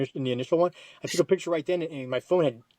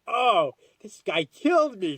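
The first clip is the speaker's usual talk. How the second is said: "Oh, this guy killed me" slow and higher, nearly shouted; shocked cry; 6 semitones higher, slower than usual. Retold or imitated. Retelling the moment he thought the stab killed him, slow shocked cry. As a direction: gasp it out in disbelief. Realizing he was stabbed, retold.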